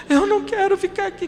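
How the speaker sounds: put-on high whiny child refusing, near tears; imitating a high child's tearful protest; crying child. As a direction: play the little son starting to cry. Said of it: "Começou a chorar".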